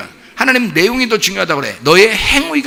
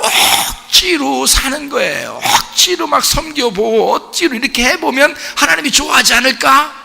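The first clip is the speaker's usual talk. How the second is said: preaching shout; 7 semitones higher than usual. Real, not acted. Preaching climax.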